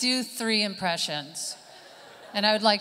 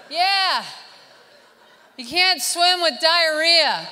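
loud, high, drawn-out telling-off; exasperated lifeguard; 7 semitones higher, 6 dB louder, slower than usual. Acted. Lifeguard explains the rule to swimmer, loud exasperation. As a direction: spell out the obvious, slowly and loudly. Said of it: "And the lifeguard's like".

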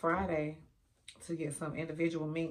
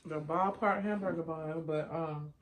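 talking while chewing; chewing food. Real, not acted. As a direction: mouth partly full. Seen 3: Mouth closed and jaw working after a spoonful; chewing stops by 3.4.

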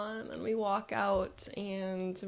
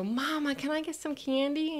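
put-on higher-pitched little kid wheedling mom; pleading child; 6 semitones higher than usual. Child asks mom for candy, pleading. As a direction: ask like an eager child. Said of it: "And I go".

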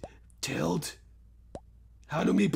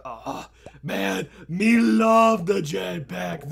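ordinary talk, nothing marked

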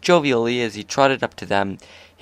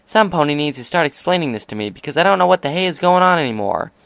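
higher, fast, rising frustration; exasperated rant; 6 semitones higher, 3 dB louder, faster than usual. A narrator's reading. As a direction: spill it out in exasperation. Rainbow exasperated.

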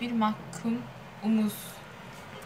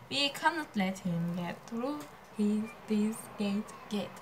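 quiet, slow word-by-word English; slow read-out; 3 semitones lower, 8 dB quieter, slower than usual. Shown as a demonstration. Rereading the gate line to write it, slow and quiet. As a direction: read it out softly and slowly as if writing.

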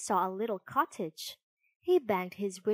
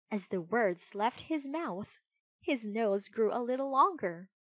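usual speaking voice

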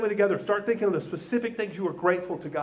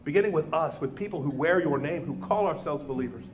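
low, earnest prayer voice; closing prayer; 3 semitones lower than usual. Acted. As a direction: lead the church in prayer, hushed and earnest.

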